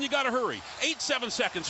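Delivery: ordinary talk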